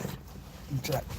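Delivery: slow, soft whisper; playful stage whisper. Pretend. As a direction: sneak a hushed whisper like you're snooping. Mock search for crying liberals.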